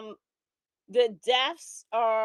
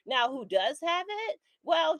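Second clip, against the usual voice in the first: ordinary delivery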